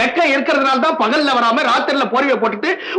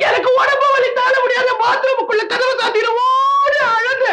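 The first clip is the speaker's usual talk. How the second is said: hammed-up crying shout; wailing cry; 11 semitones higher than usual. Retells wailing in pain comically. (அலறி அழும் குரல்)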